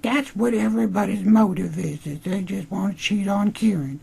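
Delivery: very high, silly falsetto; mock Emma voice. Host mocking the episode's motives, high mock falsetto. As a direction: parody a ditzy explanation in falsetto.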